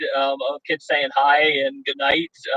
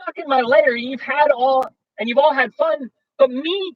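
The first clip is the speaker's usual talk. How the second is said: much higher, whiny complaint; whimpering bear; 12 semitones higher, slower than usual. Acted. Bear complaining he missed the party, high whimpering. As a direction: whine like a left-out bear. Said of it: "And he whimpers".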